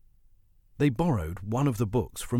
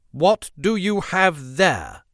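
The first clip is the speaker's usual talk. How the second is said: slow, loud, high-pitched demand; snapped; 8 semitones higher, 7 dB louder, slower than usual. A narrator's reading. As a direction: confront them sharply, drawing out each word. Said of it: "she snapped".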